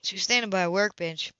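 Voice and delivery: ordinary delivery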